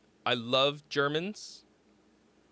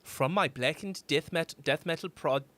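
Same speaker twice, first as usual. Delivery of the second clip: careful Bavarian-tinged German accent. Shown as a demonstration. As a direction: read it out in German-accented English. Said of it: "I will do my best German accent".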